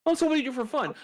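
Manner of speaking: squeaky fake-friendly asking; high chirpy coworker voice.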